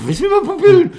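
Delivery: very high, loud, incredulous question; retold panic, voice leaping way up; mock startled comeback. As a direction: squeal it back in exaggerated disbelief.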